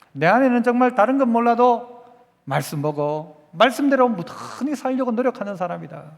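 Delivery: usual speaking voice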